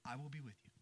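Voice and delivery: soft repeat. Acted. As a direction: drop it to a near hush. Promise repeated after the pauses.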